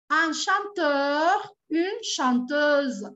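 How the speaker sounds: slow, clear drill of word pairs. Shown as a demonstration. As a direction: model each form slowly for learners. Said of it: "very slow model pair".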